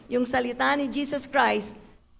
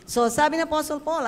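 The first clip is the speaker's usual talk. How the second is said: ordinary talk, nothing marked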